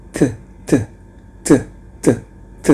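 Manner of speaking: "T" as a breathy th with the tongue between the teeth; voiceless th sound; voiceless th drilled. Shown as a demonstration. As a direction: blow air over the tongue on "T". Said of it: "tidak menimbulkan suara D".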